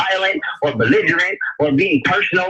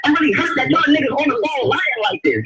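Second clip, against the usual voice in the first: mock hurt; 8 semitones higher than usual. Mock hurt at callers lying.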